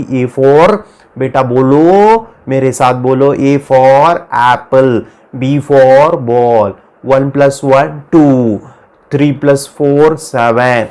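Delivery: slow, stretched classroom recitation; teacher drilling children. Acted. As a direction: draw out each word for children to repeat. Acts a teacher drilling small children. (बच्चों को रटाती teacher की धीमी, खिंची आवाज़)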